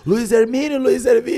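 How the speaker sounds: high-pitched mimicked dog call; imitated calling out. Husband imitates neighbours calling the dog, high calling voice.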